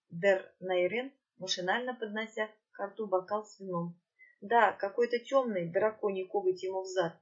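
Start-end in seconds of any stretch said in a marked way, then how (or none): none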